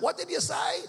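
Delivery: yelling out. Acted. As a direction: call out from the crowd, pitched high. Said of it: "just yell out".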